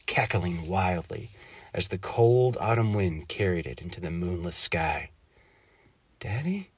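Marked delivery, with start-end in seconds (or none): 6.2-6.7 put-on scared little girl. A narrator's reading. Scared girl calls softly.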